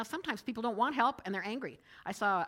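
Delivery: ordinary talk, nothing marked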